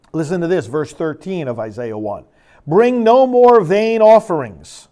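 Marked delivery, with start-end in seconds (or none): 2.7-4.9 stern command. Acted. Angry God quoted from Isaiah.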